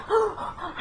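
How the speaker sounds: sharp high gasp for air; panicked gasp. Retold or imitated. Panicking in the elevator, hyperventilating gasp. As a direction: gasp like you can't breathe.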